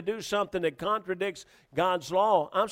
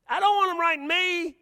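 high-pitched protest; 11 semitones higher than usual. Acted. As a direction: voice jumps way up in protest. Ex-officer protesting about getting tickets.

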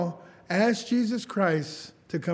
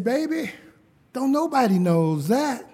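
lightly voiced old grandma, higher; a hint of an elderly grandmother; grandma's voice; 7 semitones higher, 6 dB louder than usual. Grandson quotes grandma on the phone, lightly voiced. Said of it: "And she said".

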